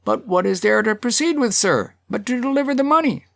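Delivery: high-pitched character voice; high, insistent heckler; the Hatter's interjection. A narrator's reading. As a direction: call it out high from the crowd. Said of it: "and the Hatter said".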